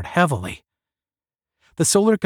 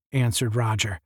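usual speaking voice

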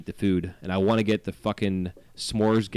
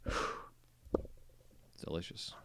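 whispered; 4 semitones lower, 17 dB quieter, much slower than usual. Real, not acted. Dreamy aside about food.

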